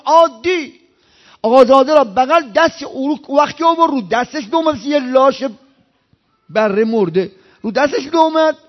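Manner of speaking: loud, high preaching at full force. Acted.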